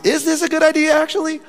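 high, thin, worried squeak; high worried mutter to himself; nervous high voice. Retold or imitated. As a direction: squeak it out like you're scared to knock. Nervous at the stranger's house.

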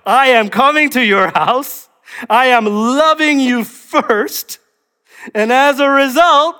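high, loud, insistent proclamation; preacher voicing Jesus at full volume; emphatic declaration. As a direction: declare it forcefully, stretching the key words. Voices Jesus correcting the order.

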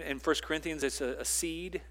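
normal talking voice

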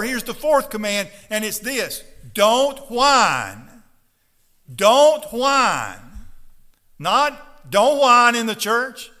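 loud, fiery preaching to the congregation; full-force preaching. Acted. Pastor urging his congregation not to whine, fiery loud preaching. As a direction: pound the message home from the pulpit.

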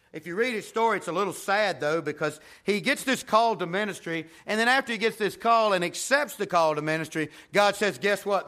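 fired-up pulpit preaching; preaching at full force. Acted. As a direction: drive the story home to the congregation. Preaching the Isaiah story.

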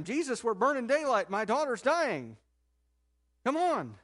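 a desperate father hurrying him along; urgent plea. Retold or imitated. Father begging Jesus to hurry, urgent and loud. Jairus urging Jesus, daughter dying.

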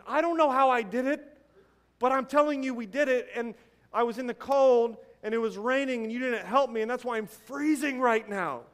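the drugged patient scolding his wife, hammed up; mock angry husband. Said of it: "he's angry with his wife".

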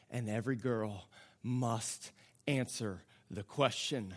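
spaced phrases, each left to settle; solemn preaching. Acted. Preacher calls every listener to decide, hushed solemn appeal.

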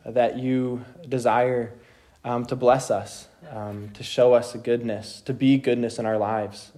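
low, measured praying voice; spoken prayer. Real, not acted. Leads the group in prayer.

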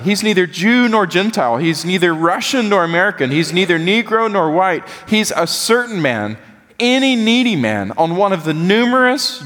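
rising preacher's cadence; impassioned preaching. Retold or imitated. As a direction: lift it like a civil-rights sermon. Preacher reads MLK sermon quote with fervor.

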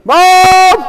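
put-on high, loud child's cry for mom; panicked yell. Little boy yelling to mom from the pool. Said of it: "I'd start yelling".

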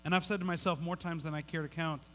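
normal talking voice

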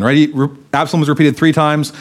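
ordinary talk

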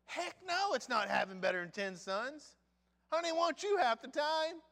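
high, loud exclaiming; mocking outburst. Real, not acted. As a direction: scoff loudly at the husband's claim. Mocking the husband's claim.

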